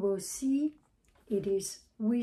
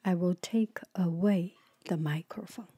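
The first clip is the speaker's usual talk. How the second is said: whisper; 3 semitones lower than usual. Real, not acted.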